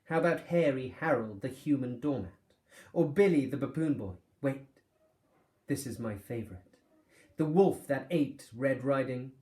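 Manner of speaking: loud, high showman's patter; fairground barker. Acted. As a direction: sell the freaks to the crowd. Fairground master shows off freaks.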